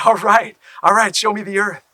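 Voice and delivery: loud, high and eager; excited. Acted. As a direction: demand it with excitement. Excited character greets Turtle.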